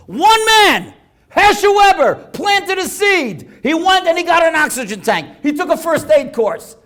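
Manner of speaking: loud, high, drawn-out proclamation; impassioned sermon climax; full-force preaching. Real, not acted. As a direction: drive the moral home at the top of the voice.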